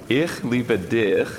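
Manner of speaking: ich liebe dich, slow and careful German. Shown as a demonstration. Wife teaching husband German phrases, slow and careful. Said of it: "teaching German".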